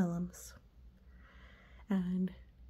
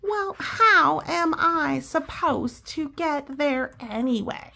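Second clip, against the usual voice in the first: higher, louder, slow complaint; Pigeon voice; 6 semitones higher, 7 dB louder, slower than usual. Acted. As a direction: whine the excuse as Pigeon. Pigeon excuse.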